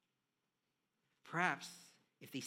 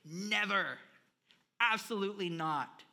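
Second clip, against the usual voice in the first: loud, high emphatic refusal; emphatic denial; 5 semitones higher, 10 dB louder than usual. Retold or imitated. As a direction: slam it down, never again. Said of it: "to emphatically say".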